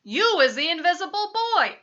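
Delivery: loud, high, thrilled exclamation; squiffy with excitement. A narrator's reading. Daddy discovers his son is invisible, squiffy with excitement. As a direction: proclaim it in giddy amazement. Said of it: "My daddy is squiffy with excitement".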